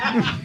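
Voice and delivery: very high, excited shriek; fanboy scream. Retold or imitated. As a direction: let out a thrilled fan scream. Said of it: "ahí sí". (chillido de fan muy agudo)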